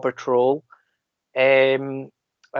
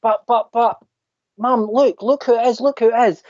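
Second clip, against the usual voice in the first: put-on higher, eager little-girl voice; imitating excited girl; 8 semitones higher than usual. Little girl telling her mum who she spotted, high and eager. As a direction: tug at mum, bursting to tell her. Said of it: "And she goes".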